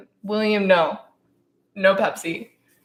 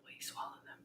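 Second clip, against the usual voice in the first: soft whispered aside; 31 dB quieter than usual. Real, not acted. Teasing co-host in a whisper. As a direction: hush it to the mic. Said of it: "whispered asides".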